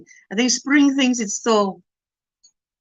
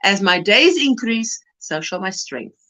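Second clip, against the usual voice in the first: solemn verse; 4 semitones lower than usual. Acted. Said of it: "That verse".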